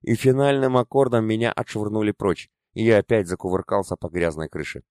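ordinary delivery

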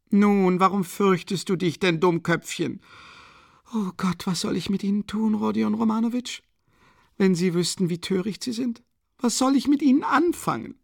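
a desperate, proud widow ranting; agitated Katharina. A narrator's reading. Narrator voices Katharina Iwanowna. (erregte, verzweifelte Mutter, höher gesprochen)

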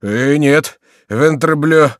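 protesting exclamation; indignant cry. A narrator's reading. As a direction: cry out with an oath. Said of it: "вскричал Горанфло".

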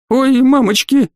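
high, slow, frightened whine; thin little wail. A narrator's reading. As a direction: wail thinly in helpless panic. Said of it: "тоненько провыл он".

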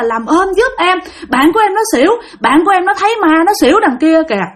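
high-pitched retold plea; urgent pleading. Girl begging a man to help her fainted friend, pleading.

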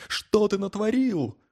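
high-pitched hysterical outburst, held in volume; narrated hysteria, high but not shouted; hysterical cry. A narrator's reading. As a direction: cry out on the verge of breakdown, pitch way up. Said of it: "Хотел истерично, на грани срыва завопить".

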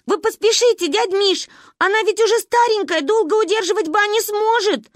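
high, loud frantic urging; panicked. A narrator's reading. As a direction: urge uncle to hurry. Panicked phone call. (тревожная мольба)

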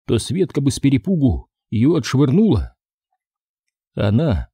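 plain reading voice

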